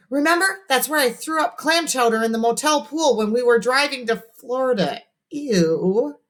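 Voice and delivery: high, lightly voiced chatty girl; know-it-all girl. A narrator's reading. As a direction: chirp it like a bright little girl. Voices Emily.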